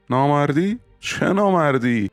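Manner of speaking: high-pitched indignant questioning; indignant echo. Acted. Accused lover throws word back. (با لحن معترض و صدای بالا)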